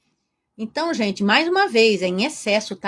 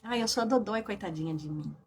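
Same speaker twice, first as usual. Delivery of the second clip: mock self-pity; 3 semitones lower, 10 dB quieter than usual. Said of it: "ai, dodóizinha, melindrosa".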